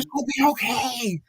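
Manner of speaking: mock friend soothing him; bad-breath friend. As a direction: say it in her comforting tone. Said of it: "She's like".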